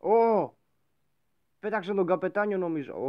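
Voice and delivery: high, loud exclamation; startled yelp at the screen; surprised shout. Real, not acted. Gamer seeing the captain thrown, surprised. Sudden game event.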